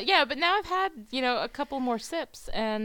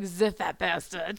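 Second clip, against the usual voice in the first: slow, jokey French accent; bad French accent; 3 semitones lower, slower than usual. Shown as a demonstration. Podcaster repeats her stupid joke for listeners, mock French accent. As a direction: draw it out in a terrible French accent. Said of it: "please don't do the accent".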